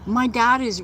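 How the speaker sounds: ordinary delivery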